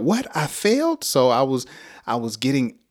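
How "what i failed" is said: sharp, rising cry of disbelief, voice jumping high; shocked disbelief. Retold or imitated. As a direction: act out hearing bad news in disbelief. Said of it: "Yeah, so I freaked out".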